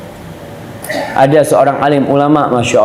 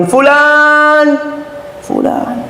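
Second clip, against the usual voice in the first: much higher, drawn-out call; mother calling; 12 semitones higher, much slower than usual. Acted. Mother calling her praying son, high and drawn out. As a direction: call out a name across the house.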